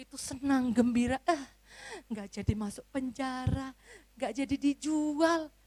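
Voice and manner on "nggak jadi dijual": normal talking voice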